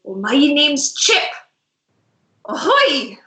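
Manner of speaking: lightly voiced jolly one-eyed sailor; a hint of a pirate hailing guests; pirate tavern owner. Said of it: "the human talks and he goes".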